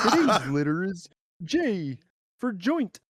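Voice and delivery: high, slow frog puppet voice; Kermit the Frog voice. Retold or imitated. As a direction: quote it as a stoned cartoon frog. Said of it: "it was Kermit the Frog and".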